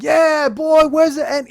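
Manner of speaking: high, very loud gibbering holler. Retold or imitated. Rapper bursts out of dressing room, wild gibbering holler. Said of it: "he's just gibbering".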